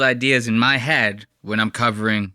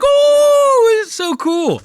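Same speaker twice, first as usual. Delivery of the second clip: very high, loud drawn-out "oooh"; hammed-up high-pitched gushing; gushing excitement; 7 dB louder, much slower than usual. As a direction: squeal it like an awestruck fan. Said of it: "I was like".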